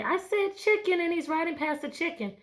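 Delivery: eager model answer. Shown as a demonstration. Teacher models a student's answer.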